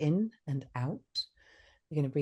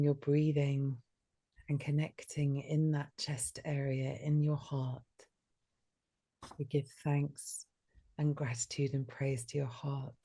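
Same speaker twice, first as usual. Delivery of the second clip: guided meditation. Acted. Leads heart meditation.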